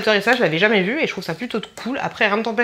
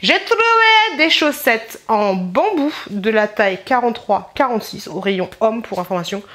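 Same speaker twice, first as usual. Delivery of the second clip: usual speaking voice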